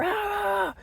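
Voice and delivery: short high mock yell "Ah!"; retold yell. Retelling the husband yelling in the car, a short mock yell. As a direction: throw out a sudden high yelp. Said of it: "yelling in the car".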